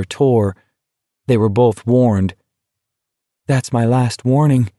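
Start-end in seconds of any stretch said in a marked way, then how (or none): none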